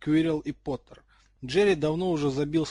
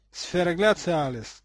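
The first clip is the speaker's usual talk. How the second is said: ordinary delivery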